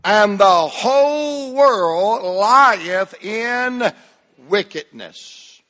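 slow, drawn-out recital with a dropping end; preacher leading a slow group reading; slow congregational recitation. Shown as a demonstration. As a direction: lead the room word by word, slowly. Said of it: "read this last part out loud with me".

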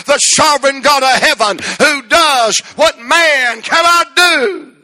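loud, high preacher's cry; full-force preaching. Acted. Preacher telling the congregation about Hannah, full-force cry. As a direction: preach it out to the rafters.

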